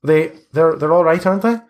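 lightly voiced anxious boy. Boy asks visitors if bones are safe, anxious. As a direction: raise the pitch, seeking assurance.

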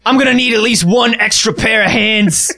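hammed-up Australian accent. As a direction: complain like a put-upon Australian. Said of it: "Can we promise not to do Australian accents".

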